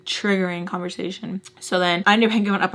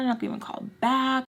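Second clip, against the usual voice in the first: whispering; 5 semitones higher, 4 dB quieter, faster than usual. Retold or imitated. Whispered angry quote to Tommy.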